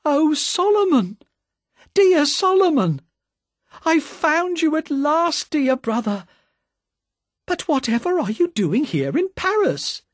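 high, loud joyful cry of recognition. Acted. Sister spots her brother in a wine shop, high loud cry. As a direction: call out to a long-lost brother. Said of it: "cried out in a loud voice".

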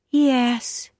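put-on high child voice, a touch of sadness; high-pitched, mildly sad child; sad little girl. A narrator's reading. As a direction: answer as a downcast small girl. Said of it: "said the little girl sadly".

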